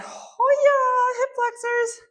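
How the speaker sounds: high strained effort cry; squeezing out words under strain. Real, not acted. Exertion during hip stretch.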